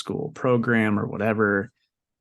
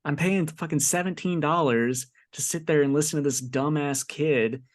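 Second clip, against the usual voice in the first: ordinary delivery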